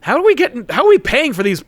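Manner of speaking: puzzled questioning. Acted. Acted characters puzzled over funding.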